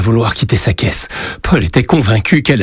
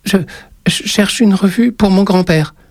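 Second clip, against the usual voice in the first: put-on higher child's voice, stammering; a scared boy forcing out a greeting; timid little boy; 12 semitones higher than usual. A narrator's reading. Frightened boy asks for magazine. (petit garçon intimidé qui bafouille)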